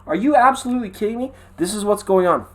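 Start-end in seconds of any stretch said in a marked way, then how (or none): 0.1-1.3 exasperated disbelief. Retold or imitated. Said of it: "I was like".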